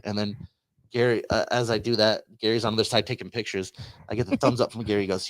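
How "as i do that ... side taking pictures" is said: ordinary talk, nothing marked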